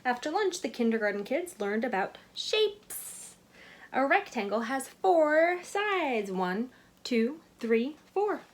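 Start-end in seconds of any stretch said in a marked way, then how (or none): 3.9-8.4 slow, higher, louder teacher counting. A narrator's reading. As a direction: count it out for little kids. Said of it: "said the teacher".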